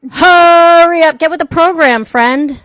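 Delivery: loud, slow, drawn-out yelling; impatient shouting. Real, not acted. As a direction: yell at them to hurry. Impatiently yelling at other speaker.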